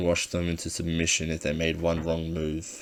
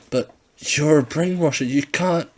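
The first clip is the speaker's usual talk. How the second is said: high, louder panicked voice; scared protest; 9 semitones higher, 6 dB louder than usual. Acted. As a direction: protest in rising fear. Ringleader scared.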